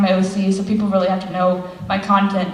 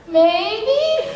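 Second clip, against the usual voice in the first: coy high maybe; 12 semitones higher than usual. Retold or imitated. Answering the fan at school, coy and high. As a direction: sing it up high, coy and laughing.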